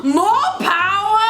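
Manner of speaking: very high, slow, loud shout; mock TV mom yelling at her daughter; loud demand. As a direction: bellow it like the TV mother. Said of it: "she's like".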